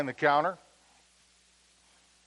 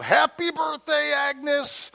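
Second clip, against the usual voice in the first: high, loud yelled greeting; surprise shout; 14 semitones higher, 9 dB louder, slower than usual. Retold or imitated. Crowd surprising Agnes at the diner, loud shout. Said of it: "they yelled out".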